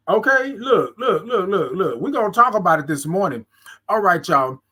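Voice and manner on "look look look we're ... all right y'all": usual speaking voice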